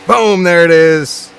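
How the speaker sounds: loud, slow triumphant call; excited boom. Real, not acted. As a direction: land it like a victory. Celebrating the fix on stream.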